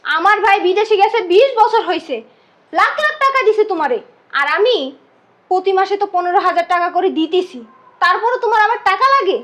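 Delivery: loud, high indignant accusation; angry reproach. Acted. Girl to sister-in-law about brother's money, angry reproach. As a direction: throw it at her, loud and heated.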